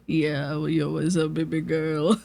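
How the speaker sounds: low cocky pickup-line tone; fuckboy voice. Retold or imitated. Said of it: "e-boy, fuckboy voice".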